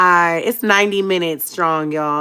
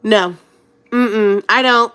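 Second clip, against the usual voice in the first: stubborn refusal; 5 semitones higher, 7 dB louder, slower than usual. Retold or imitated. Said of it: "I'm like".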